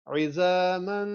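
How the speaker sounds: عظاماً with the meem and noon merged, nasal ghunnah; nasal merge. Shown as a demonstration. Teacher demonstrating عظاماً to students. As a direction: draw out عظاماً through the nose. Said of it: "ذرا غور کریں کیسے پڑھیں گے".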